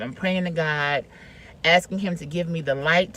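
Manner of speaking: ordinary talk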